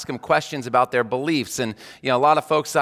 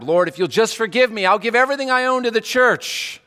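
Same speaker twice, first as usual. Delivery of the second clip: bribing prayer; 7 semitones higher than usual. Retold or imitated. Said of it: "Or we bribe".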